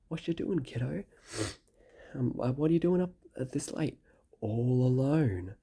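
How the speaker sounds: high, pushy eshay lad voice; aussie eshay slang. Retold or imitated. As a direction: lean in like a creepy eshay. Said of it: "and he says".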